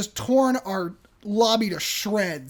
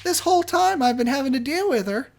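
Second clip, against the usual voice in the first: ordinary talk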